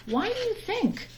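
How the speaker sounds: ordinary delivery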